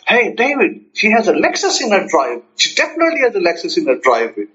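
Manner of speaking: acted excitement building into a quick finish; excited. Telling David about car in driveway, excited. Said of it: "to my friend in excitement".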